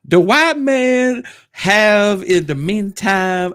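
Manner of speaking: put-on voice. Put-on high voice reading.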